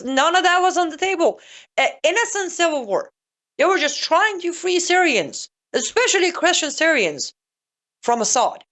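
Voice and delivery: high-pitched mock innocence; sarcastic. Commentator mocking the rebels' cover story to viewers, sarcastic. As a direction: feign earnest belief in a high, sarcastic voice. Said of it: "this is all sarcasm".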